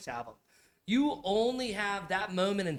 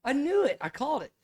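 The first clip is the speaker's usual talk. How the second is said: excited; 10 semitones higher, 5 dB louder than usual. Real, not acted. Guessed the costume right.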